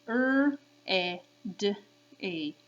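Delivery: R, E, D, E as separate speech sounds; sounded out. Shown as a demonstration. Teacher repeating for kids, sounded out. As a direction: say R, E, D, E one sound at a time. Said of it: "One more time with the sounds".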